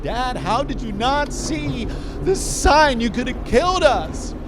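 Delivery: high, loud tearful yell; crying yell. Retold or imitated. A shaken child yells at his drunk dad after the crash, loud. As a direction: yell through tears after the near crash. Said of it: "I'm yelling at him like".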